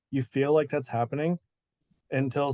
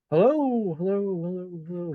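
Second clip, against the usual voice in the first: ancient dwarven woman's greeting with a mouth-made echo; 5 semitones higher than usual. Acted. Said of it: "it, like, continues to echo".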